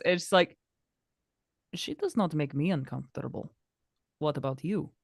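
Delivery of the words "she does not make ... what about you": lightly voiced cool game heroine; measured, slightly put-on character voice; Katarina. Katarina answering a remark about discomfort, lightly in character.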